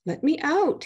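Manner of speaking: understated scream from the trapped spirit; mild scream. A narrator's reading. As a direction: shriek it from inside the bottle. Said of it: "he screamed".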